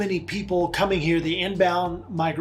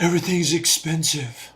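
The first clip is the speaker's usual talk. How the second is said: put-on dumb teenager voice. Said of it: "my, uh, dumb teenager voice".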